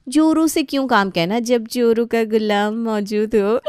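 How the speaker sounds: sister-in-law mocking husband, laughing; laughing taunt.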